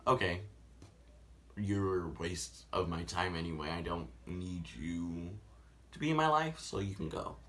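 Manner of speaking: soft cold dismissive. Retold or imitated. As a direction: brush him off coldly. Her cold reply.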